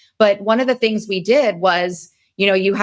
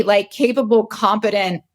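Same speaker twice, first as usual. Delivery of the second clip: ordinary talk, nothing marked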